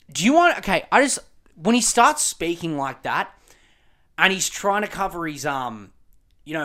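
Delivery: ordinary delivery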